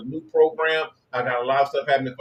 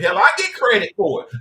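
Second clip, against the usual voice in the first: higher, louder brag; raised proud boast; 6 semitones higher, 6 dB louder than usual. Acted. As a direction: talk it up proudly.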